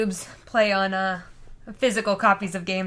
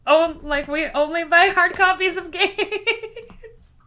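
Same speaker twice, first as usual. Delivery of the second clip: laughing through the words, louder and higher; giggly, raised, amused protest; laughing reply; 6 semitones higher, 4 dB louder, slower than usual. Retold or imitated. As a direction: answer while still cracking up. Retelling own reply, laughing.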